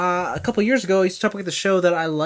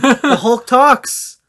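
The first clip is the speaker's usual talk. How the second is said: slow, loud and high, every word hit; excited emphasis; 9 semitones higher, 10 dB louder, much slower than usual. Real, not acted. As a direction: announce it slowly and loudly, thrilled. Excited news told to friend.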